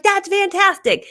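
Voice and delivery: high, loud, slow delighted praise; excited praise. Retold or imitated. Cheering a friend whose reel went viral, excited praise. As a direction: gush over the news.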